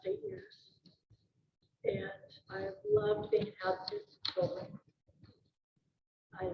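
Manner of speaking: holding back tears through a thank-you; low, voice catching with real emotion; choked up. Real, not acted. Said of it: "just in case I got choked".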